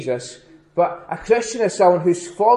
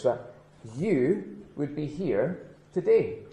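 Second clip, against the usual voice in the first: normal talking voice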